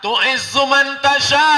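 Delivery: slow, high chanted Arabic; Quranic recitation. Acted. Preacher chanting the verse to the crowd, slow and high. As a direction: intone the verse.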